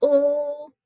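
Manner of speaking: teacher sounding out "ul"; isolated syllable. Shown as a demonstration. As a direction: say "ul" as a lone, steady syllable. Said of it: "Here's our second syllable".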